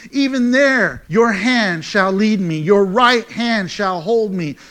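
loud, ringing preacher's reading of the psalm; Psalm read out loud and slowing at the end, like a pulpit call; full-force scripture reading. Acted. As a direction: proclaim it to the back row.

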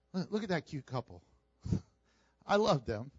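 ordinary talk

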